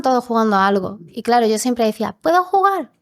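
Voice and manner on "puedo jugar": put-on child asking. Child asking to join games.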